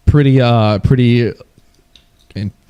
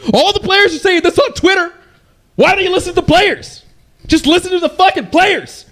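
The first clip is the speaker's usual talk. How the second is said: high-pitched mock rant of outraged gamers; ranting angry players. Streamer mimicking players who demand developers listen, high mock rant. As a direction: imitate whiny demanding players, pitch climbing higher and higher.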